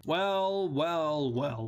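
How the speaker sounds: drawn-out wells stepping down in pitch; knowing "well, well, well". Acted. Mock-knowing reveal after asking how.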